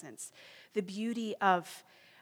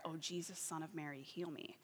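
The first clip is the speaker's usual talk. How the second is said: softer, murmured prayer; soft repeated prayer; 2 semitones lower, 9 dB quieter than usual. Retold or imitated. Praying over and over alone, softly murmured. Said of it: "she would pray this prayer".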